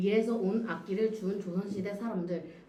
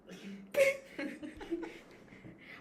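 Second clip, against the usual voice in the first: high, loud, slow giggling protest; protesting while cracking up; stifled giggles; 12 semitones higher, 15 dB louder, slower than usual. Real, not acted. Presenter breaks down giggling.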